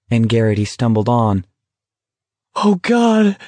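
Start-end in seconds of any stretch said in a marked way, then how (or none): 2.5-3.4 high, strained gasp. A narrator's reading. Garrity is hauled up, helpless with laughter, gasping. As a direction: squeeze it out, winded from laughing. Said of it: "Garrity gasped".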